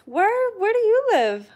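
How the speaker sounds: high, loud surprised ask; surprised question. Real, not acted. Asking a viewer where they live, surprised and high. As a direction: jump up in pitch, delighted.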